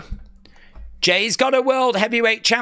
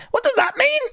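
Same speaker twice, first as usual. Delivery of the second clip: baffled. Real, not acted. Baffled by emojis.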